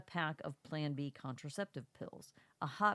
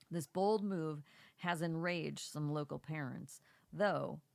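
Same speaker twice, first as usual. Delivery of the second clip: ordinary talk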